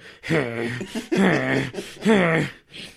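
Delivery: repeated loud, effortful grunting; mimicked exaggerated grunts of exertion; imitated wrestling grunts. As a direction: grunt like a wrestler straining hard. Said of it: "Matt's grunts".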